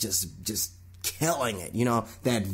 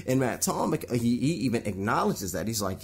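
ordinary talk, nothing marked

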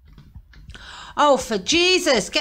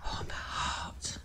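whisper; 16 dB quieter, slower than usual. Real, not acted. Jump scare in horror game.

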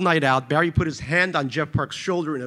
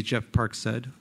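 plain reading voice

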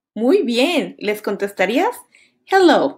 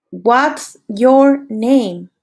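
very slow, word by word; slow model phrase; much slower than usual. Shown as a demonstration. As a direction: spell it out slowly for learners. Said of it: "Listen and repeat".

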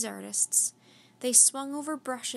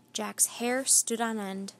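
usual speaking voice

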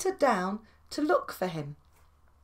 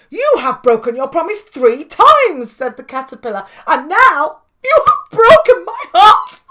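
much louder, with a laughing cry on the last words; heartbroken; 4 semitones higher, 12 dB louder than usual. Acted. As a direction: wail that your heart is broken. Caterpillar heartbroken.

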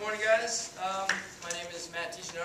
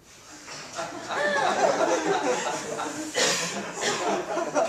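loud cackling laugh; 11 semitones higher, 5 dB louder than usual. Acted. Kenny laughing mid-speech.

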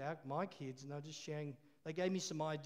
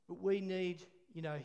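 ordinary talk

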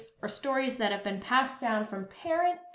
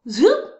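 higher, louder rising zoop; 7 semitones higher, 12 dB louder than usual. A narrator's reading. Narrator sounds the wand making a glass vanish. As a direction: zap it away.

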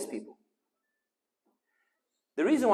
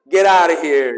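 loud dismissal with a hint of American accent; mock American accent; 12 dB louder, slower than usual. Doctor sending the patient away, louder mock-American accent. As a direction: throw it out loudly, imitating an American. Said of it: "as you guys say".

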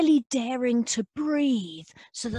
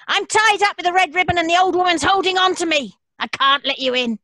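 high, fast, louder little object voice; the key's voice; 6 semitones higher, 5 dB louder, faster than usual. Acted. The key refusing the creatures, high and quick. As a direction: rattle it off like a smug key. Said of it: "And the key replied".

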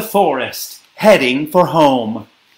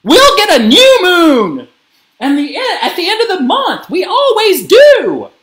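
high, loud motherly explanation; screaming; 7 dB louder than usual. A narrator's reading. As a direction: call out reassurance at top volume. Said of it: "screamed Gabby's mom".

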